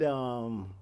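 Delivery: ordinary delivery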